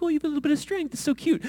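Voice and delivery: put-on high, condescending baby-talk pity; mocking. Shown as a demonstration. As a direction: coo it down at them as if it's cute. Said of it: "like, mocking them".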